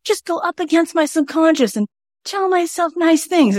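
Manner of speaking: high, put-on voice mocking a self-help believer; airy high mock voice; mocking naive optimist. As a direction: mimic someone who thinks positive talk fixes everything. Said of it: "when people are like".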